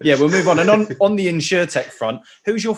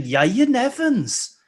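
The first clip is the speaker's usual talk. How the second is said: put-on slow, sing-song Welsh accent, pitched up; slower than usual. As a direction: stretch it out in a hammed-up Welsh lilt. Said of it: "whenever I need to do a Welsh accent".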